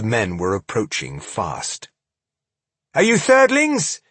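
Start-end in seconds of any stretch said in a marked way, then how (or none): 2.9-4.0 high, loud sharp question. Acted. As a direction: ask it sharply, suspicious. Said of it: "she asked sharply".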